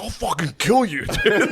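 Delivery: high, laughing threat; mock threat. Wood spazzing on his friend, a high laughing threat. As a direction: play the furious friend. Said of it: "he was like".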